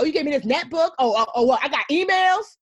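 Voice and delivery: high, mocking lilt that rises; mock the netbook with sarcasm; sarcastic listing of what God gave. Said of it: "listing things sarcastically".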